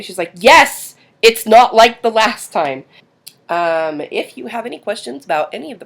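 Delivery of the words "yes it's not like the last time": loud, high, insistent retold reply; exasperated daughter. Bugged daughter reassures mom on school drive, exasperated. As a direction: imitate a kid fed up with being asked.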